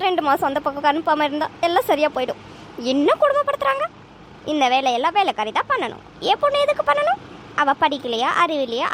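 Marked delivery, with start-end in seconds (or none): 2.9-3.9 indignant outburst. Acted. Mother outraged at daughter's in-laws. 6.3-7.2 indignant outburst. Acted. Mother outraged at daughter's in-laws.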